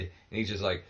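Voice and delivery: ordinary delivery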